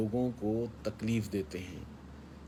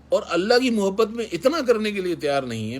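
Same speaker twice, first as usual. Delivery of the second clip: fervent raised voice; 8 semitones higher, 6 dB louder than usual. Real, not acted. Preacher's fervent reproach.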